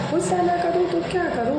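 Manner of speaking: exasperated mother. Acted. Mother fed up with her daughter ignoring her calls, fast exasperated retort. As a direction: snap it back quick and high, fed up. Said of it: "क्यों इतना गुस्सा कर रही हो?".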